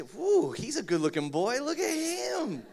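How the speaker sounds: high, loud swooning wife voice, stretching out the last words. Pretend. Wife admiring her husband's photo, high swooning squeal. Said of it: "Yeah, she".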